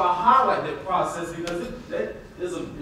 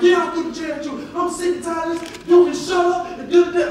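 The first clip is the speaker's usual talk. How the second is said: lightly voiced defiant kid, higher and louder; defiant child; 11 semitones higher, 6 dB louder than usual. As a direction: play the mouthy kid throwing it back. Voicing the angry child.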